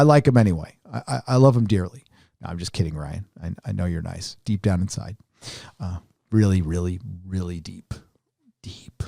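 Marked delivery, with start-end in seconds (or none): 2.4-7.9 soft, low teasing aside; quiet teasing. Real, not acted. 8.6-9.0 breathy whisper. Real, not acted. Teasing aside to Ryan.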